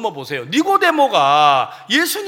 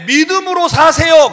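preaching line; 7 semitones higher, 8 dB louder than usual. Shown as a demonstration. Pastor voiced to congregation.

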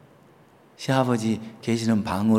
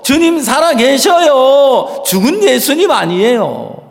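fervent preaching; 11 semitones higher, 8 dB louder than usual. Real, not acted. Pastor preaching full force to the congregation about hope. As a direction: proclaim it with full conviction.

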